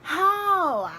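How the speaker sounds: a high, loud, strained "How?"; incredulous whine. Retold or imitated. Daughter asking mom how she can skip work, high loud whine. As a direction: cry out in disbelief. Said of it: "I'm like".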